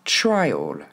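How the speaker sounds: TRIAL as "TRY-ol", strong TRY; first-syllable stress. Shown as a demonstration. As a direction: join "try all" into TRIAL, punching the start. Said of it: "une fois qu'on a mis l'accent tonique sur la première".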